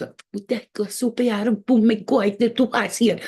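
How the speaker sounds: stern Latin aunt in Spanish; Cuban Spanish auntie. Retold or imitated. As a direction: speak as a no-nonsense Cuban aunt. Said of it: "She's speaking like that".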